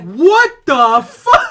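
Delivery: slow, drawn-out What the fuck through a laugh; baffled and cracking up; stunned laughing disbelief. Retold or imitated. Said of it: "And I was like".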